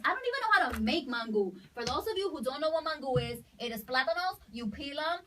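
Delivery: ordinary talk, nothing marked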